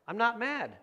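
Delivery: a hammed-up irritated retort; mock snappy denial. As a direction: imitate a touchy denial that sounds mad. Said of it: "you sound mad".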